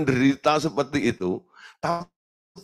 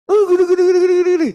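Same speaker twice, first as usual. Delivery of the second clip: very high, loud mimicking of her endless excuses; mock wife; 10 dB louder, much faster than usual. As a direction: parrot her complaints shrilly. Mocking the wife's excuses. (meniru keluhan istri, sangat tinggi dan keras)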